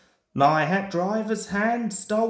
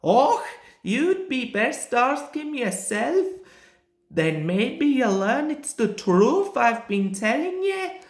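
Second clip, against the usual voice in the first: offended Donald; 6 semitones higher than usual. A narrator's reading.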